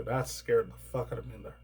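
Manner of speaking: ordinary talk, nothing marked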